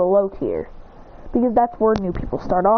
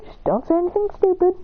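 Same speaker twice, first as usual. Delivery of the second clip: worried warning; 9 semitones higher than usual. Retold or imitated. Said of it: "He goes".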